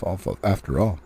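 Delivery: ordinary delivery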